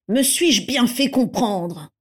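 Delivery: slow, pointed demand; insistent. A narrator's reading. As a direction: press each word home slowly. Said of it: "Insista Piscus Crabbe".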